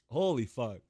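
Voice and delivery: usual speaking voice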